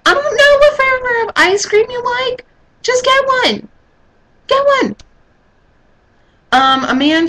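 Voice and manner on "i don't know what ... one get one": high-pitched exasperated outburst. Acted. Woman fed up with boyfriend asking about ice cream, exasperated. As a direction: snap back in a high, fed-up voice. Acted exasperated answer to indecisive man.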